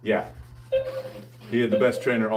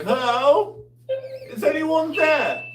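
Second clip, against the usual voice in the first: much higher, louder little voice; lantern voice; 4 dB louder, slower than usual. Pretend. Voicing his little lantern water jug, high and tiny. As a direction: squeak it out like a tiny lantern calling. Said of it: "I'm just like".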